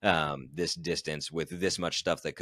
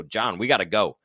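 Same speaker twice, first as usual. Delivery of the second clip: loud, urgent call to move out; 3 semitones higher, 7 dB louder than usual. Retold or imitated. To his pacer at the closing aid station, loud and urgent. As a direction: snap your buddy into action. Urging pacer to leave now.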